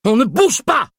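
very high, loud bawled order. A narrator's reading. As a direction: bawl it in panic. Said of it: "brailla Bloch".

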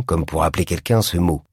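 plain reading voice

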